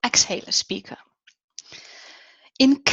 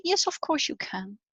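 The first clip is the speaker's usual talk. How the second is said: soft, higher, gentle reassurance; feminine way; 4 semitones higher, 9 dB quieter than usual. Shown as a demonstration. Coach showing a feminine way of speaking, softly reassuring. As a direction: answer lightly and much more softly. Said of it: "or in a feminine way".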